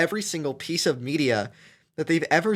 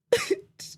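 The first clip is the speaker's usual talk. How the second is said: teary laugh; 4 dB louder than usual. Real, not acted. Crying laugh.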